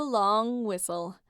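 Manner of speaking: ordinary delivery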